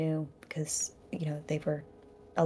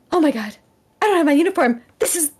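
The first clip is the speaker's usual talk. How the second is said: flustered panic; 6 semitones higher, 5 dB louder than usual. Acted. Kebeth panics about uniform.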